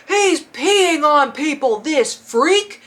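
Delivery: hammed-up indignation; outraged concertgoer. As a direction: imitate a disgusted tattletale shouting. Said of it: "he's like".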